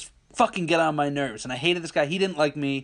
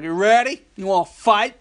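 louder, higher brash challenge; mock tough challenge; 6 semitones higher, 7 dB louder than usual. Boxer imitating a rival calling him to fight, loud taunting. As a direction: taunt like a big lummox. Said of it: "the lummox comes out and he's like".

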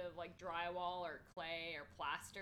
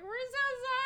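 very high, loud wail; mock wailing apology; 11 dB louder than usual. Apologising to screaming sophomores, wailing. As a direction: whine the apology.

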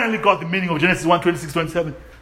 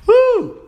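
high loud church woo; joyful whoop; 5 dB louder than usual. Real, not acted. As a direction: cry out with delight. Preacher's ecstatic cry.

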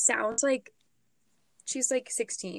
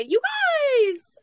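squealed, stretched-out call; emphatic catchphrase; 14 semitones higher, 7 dB louder than usual. Shown as a demonstration. Showing off own pet phrase, high loud squeal. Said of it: "I say".

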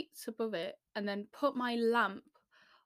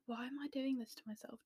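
hushed, muttered to herself; whispered self-doubt; 3 semitones higher, 9 dB quieter than usual. Retold or imitated. Whispering to herself while filming audition takes. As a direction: whisper it as a private worry. Inner thought quoted.

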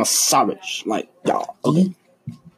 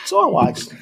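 squeaky start; 4 dB louder than usual. Real, not acted. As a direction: jump high and squeak the opening. Starting the joke.

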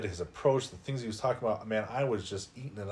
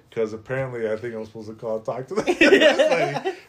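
laughing through the words, louder and fast; giggling it out in disbelief; amused rush of words; 3 semitones higher, 9 dB louder, faster than usual. Real, not acted.

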